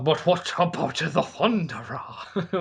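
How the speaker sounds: a hint of a worried giant king. Ymir asking Loki about Thor, lightly voiced. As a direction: ask it like a nervous giant. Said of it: "how he said that".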